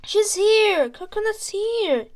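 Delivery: loud, high, gleeful cry; excited children. Acted. Children shouting gleefully as the donkey arrives. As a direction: shout it out with joy. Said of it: "the children laughed".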